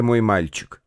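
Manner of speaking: usual speaking voice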